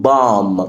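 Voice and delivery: "bomb" ending on M, no B; silent B. Shown as a demonstration. Teacher models the word, "bomb" with silent final B. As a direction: say "bomb" as "bom". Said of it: "هنا ال B silent، فبنقول".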